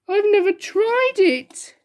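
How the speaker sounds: high, slow, weepy plea; sniffling. Acted. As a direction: sniff it out sadly. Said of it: "sniffed the king".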